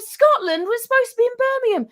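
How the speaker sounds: very high, loud, fast squeal of alarm; panicked disbelief. Retold or imitated. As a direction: shriek it in horror. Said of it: "I said".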